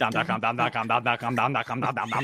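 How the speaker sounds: "Dom dot com" rattled off without a gap; "Dom dot com" run together fast into a mumble-jumble; rapid-fire repetition. Shown as a demonstration. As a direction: race through "Dom dot com" like a tongue-twister. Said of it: "Say that five times fast".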